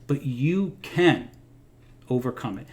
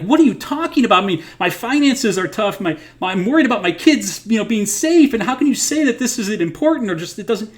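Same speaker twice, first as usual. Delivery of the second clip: worried listener; 8 semitones higher, 4 dB louder than usual. Retold or imitated. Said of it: "you'd be like".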